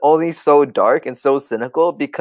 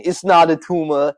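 Arnold impression; 3 semitones higher than usual. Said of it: "Kindergarten Cop".

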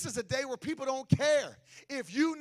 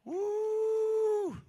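preacher's whoop; 11 semitones higher than usual. Acted. Preacher's cry.

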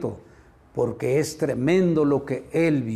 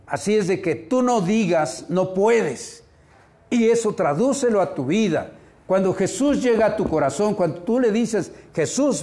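fervent preaching; 6 semitones higher than usual. Acted. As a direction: rise up and press the congregation hard. Preacher builds to fervent exhortation.

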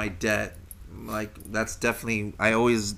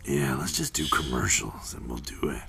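deep announcer voice; 9 semitones lower than usual. Acted. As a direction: drop into a commercial voiceover. Keeps the ad voice going.

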